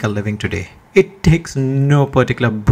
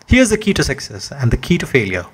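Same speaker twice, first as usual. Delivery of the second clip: ordinary delivery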